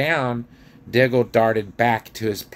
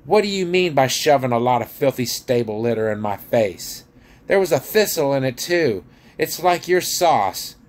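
loud, outraged dwarf voice, pitched up; angry raised-voice tirade; shouting dwarf; 5 semitones higher, 5 dB louder than usual. A narrator's reading. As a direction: scold loudly in outrage. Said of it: "he shouted".

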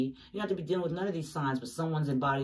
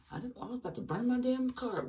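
exasperated outburst; 9 semitones higher, 4 dB quieter than usual. Real, not acted. Reacting to a card, exclaiming.

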